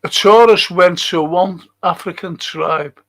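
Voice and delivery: ordinary delivery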